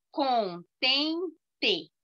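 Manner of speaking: "Contente" split into three separate syllables; teacher counting out the beats of "Contente". Shown as a demonstration. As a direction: say "Contente" as con, ten, te with clear gaps. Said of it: "três sílabas".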